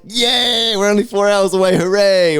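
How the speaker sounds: high, mock-cheery sarcasm; sarcastic cheer; sarcastic hooray. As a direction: fake celebration, way up high. Mock cheer about a long drive.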